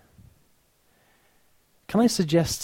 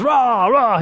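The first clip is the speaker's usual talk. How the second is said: mock shout; 10 semitones higher, 7 dB louder than usual. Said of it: "if Nigel was".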